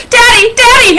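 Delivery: put-on high, loud child's call; acted joyful shouting, pitch way up; excited yell. A narrator's reading. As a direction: yell out to Daddy across a crowd. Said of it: "I yell".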